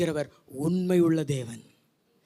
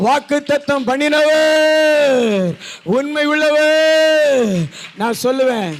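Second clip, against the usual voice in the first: loud, rousing sermon cadence; full-force preaching; 6 semitones higher, 13 dB louder than usual. Real, not acted. Preacher assuring worried congregation about children and debts, full-force preaching. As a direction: preach it loud, stretching the final words.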